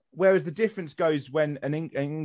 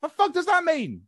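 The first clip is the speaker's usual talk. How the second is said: high-pitched, incredulous outburst; exasperated rant; 10 semitones higher, 5 dB louder than usual. Acted. Arguing with self-styled Anglo-Saxons, exasperated. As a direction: climb high and louder in comic disbelief.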